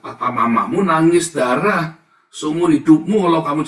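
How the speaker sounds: loud, impassioned cry; loud preaching take. Acted. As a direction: shout it from the pulpit. First, loud take of the line. (seruan keras penuh emosi)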